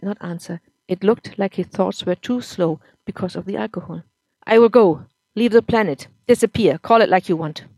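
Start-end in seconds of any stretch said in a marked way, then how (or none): 4.5-7.6 put-on loud, bitter drunk husband; drunk angry husband. A narrator's reading. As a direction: spit it out at the wife, louder.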